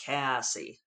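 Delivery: Cassie in her own Western American drawl; low Western American take on Cassie. Shown as a demonstration. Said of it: "Western U S drawl".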